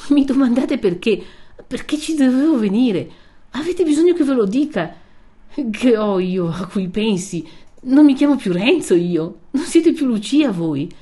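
wounded, emphatic lover's remonstrance; indignant reproach. A narrator's reading. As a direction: throw the question back indignantly. Renzo indignant at being questioned.

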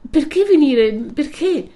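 high, loud anguished question; agitated cry of protest; distressed pleading. A narrator's reading. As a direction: plead to know why, pitched far up. Lucia pleads with Renzo.

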